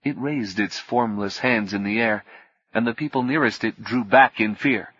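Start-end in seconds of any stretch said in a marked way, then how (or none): none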